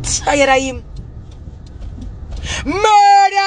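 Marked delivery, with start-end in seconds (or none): 2.7-3.4 high, loud wailing shout; short high cry of murder; outraged scream. Real, not acted. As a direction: scream it like raising the alarm.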